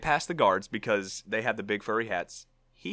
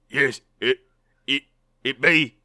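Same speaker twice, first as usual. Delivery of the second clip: put-on halting, broken English with British accent; loud, faltering attempt at broken-English British; 2 semitones higher, 6 dB louder than usual. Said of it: "broken English in a British accent".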